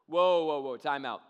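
loud first whoa, then easing; acted-out protest; mock alarmed interruption. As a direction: cut in loudly to halt the story.

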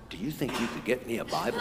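soft, hushed secretive request; imitated whisper. Sister secretly asking for a Bible too, whispering. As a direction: echo the furtive whisper, quietly. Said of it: "he whispered to me".

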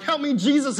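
loud, high cry for help; loud high cry of help me, Jesus. Retold or imitated. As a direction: shout it up like you're falling. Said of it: "I'm like".

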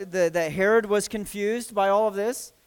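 ordinary delivery

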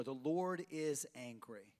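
slow, low and grave; grave pronouncement. Real, not acted. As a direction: weigh each word down. Solemn statement of last point.

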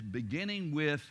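ordinary delivery

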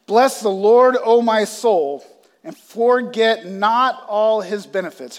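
loud, slow solemn reading of the psalm; scripture reading. Acted. Pastor reads the psalm aloud to the church, solemn and loud. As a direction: proclaim the verses to the congregation.

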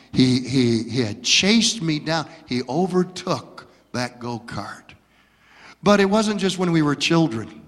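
ordinary delivery

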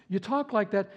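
usual speaking voice

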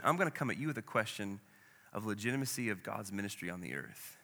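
ordinary talk

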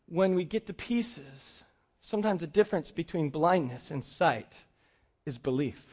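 softened, hushed reflection on belief; soft preaching close. Acted. Sermon point.

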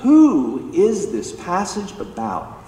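"Who" drawn out, high and loud; stress on WHO. Retold or imitated. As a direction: land hard on "Who", slowly. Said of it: "He starts with this".

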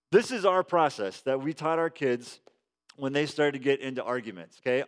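ordinary delivery

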